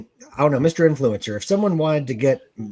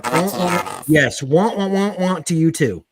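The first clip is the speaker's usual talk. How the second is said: Peanuts teacher voice; 3 semitones higher, 3 dB louder, slower than usual. Retold or imitated. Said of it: "you sound like a robot that's huffed a bunch of helium".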